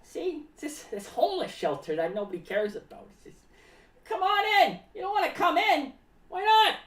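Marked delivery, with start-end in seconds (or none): 4.1-6.8 high-pitched hammed-up invitation; exaggerated coaxing; acted calling out. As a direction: call people in, then protest their refusal. Acted calling people into the shelter.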